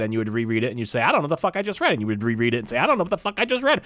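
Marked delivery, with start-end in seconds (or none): 1.0-1.9 higher, more baffled repeat; baffled reader. Retold or imitated. Repeated, rising exasperation. 2.8-3.8 high, fast, totally lost; baffled reader. Retold or imitated. Repeated, most exasperated.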